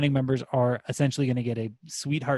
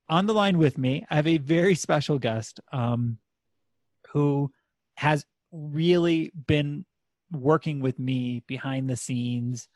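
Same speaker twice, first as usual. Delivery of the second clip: usual speaking voice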